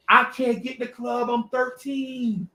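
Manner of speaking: high-pitched mock protest, dragged at the end; exasperated kid. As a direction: plead the obvious to clueless grown-ups. Kid protests to grown musicians.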